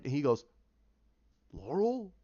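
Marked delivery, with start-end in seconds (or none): 1.5-2.1 lightly voiced old man, pitched up in surprise; startled old-timer, higher voice; old grizzled man. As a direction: act out an old man's puzzled surprise. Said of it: "you see this old gizzled man going".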